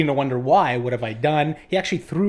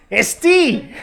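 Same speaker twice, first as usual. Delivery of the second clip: Isti much higher and louder, cracking up; laughing punchline; 14 semitones higher, 9 dB louder than usual. Retold or imitated. Teacher cracking up, Isti much higher and louder. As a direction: blurt Isti through laughter. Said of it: "And he starts laughing".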